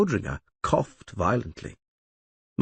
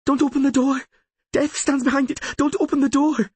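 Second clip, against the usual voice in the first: high, loud desperate scream; 4 dB louder than usual. Acted. Ciri screams at the lawyer not to open the door. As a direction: shriek a warning in terror. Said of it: "Ciri screamed".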